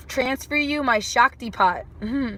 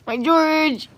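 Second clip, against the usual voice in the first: higher cooing pet talk; doting coo; 5 semitones higher, 4 dB louder than usual. Real, not acted. Owner doting on cat George, high coo. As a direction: coo the pet's name adoringly.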